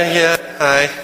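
flat, deflated hello; a distracted, unimpressed hi; unenthused greeting. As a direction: answer like a wet blanket. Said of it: "kinda like a wet blanket".

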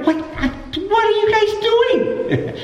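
very high, drawn-out mock disbelief; sputter, then stretch it out high, hammed-up; acted exasperation. Jesus to his disciples in the boat, exasperated. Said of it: "because it says he was exasperated".

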